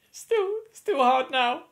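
high, choked-up pretend upset; hammed-up weepy voice; mock tearful. Mock upset over England losing final.

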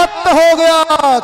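high, loud, slow stretched call; drawn-out hype. Real, not acted. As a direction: stretch it out for the crowd. Co-commentator joins the hype.

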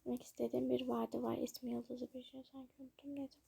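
muttered to herself, unvoiced; whispered aside. Real, not acted. Vlogger forgetting a word mid-video, whispered aside. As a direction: trail off into a whisper.

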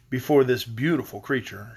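ordinary delivery, nothing marked

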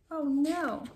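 usual speaking voice

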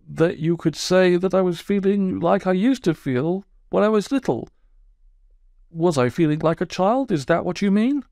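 sulky, wounded toad telling his woes; aggrieved Toad. Acted. Toad voiced by narrator.

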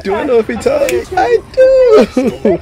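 very high, loud mocking voice; mock him in a shrill squeal; squeaky mocking imitation. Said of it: "He said".

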